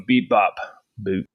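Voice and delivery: beeping robot sounds; spoken like a little robot. Pretend. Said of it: "Here we go".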